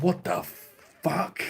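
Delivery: high, drawn-out retold disbelief; stunned disbelief. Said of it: "I'm like".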